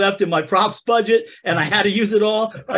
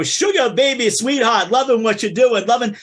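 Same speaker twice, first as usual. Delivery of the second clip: gushing showbiz agent, pitched up; 4 semitones higher than usual. Acted. Two friends mocking Hollywood agents, gushing voice. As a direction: schmooze like a fake Hollywood agent. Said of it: "acting like two L A agents".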